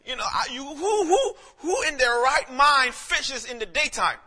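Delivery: loud, high preacher's cry; preaching. Acted. Pastor preaching at full force to the congregation.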